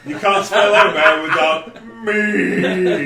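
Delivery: slow, loud, laughing punchline; laughing through it. Real, not acted. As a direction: giggle out the joke slowly. Joking amid group laughter.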